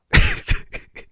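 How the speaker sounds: unvoiced burst of laughter; airy laugh. Real, not acted. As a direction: laugh at how silly it is. Laughs at the silly plot.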